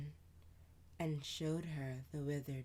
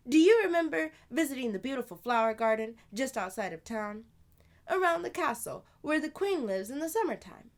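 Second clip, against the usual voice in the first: higher, louder, quick big-sister voice; older sister voice; 10 semitones higher, 12 dB louder than usual. Acted. Sister answering Amani, lively and louder. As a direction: play the lively older sister telling a tale.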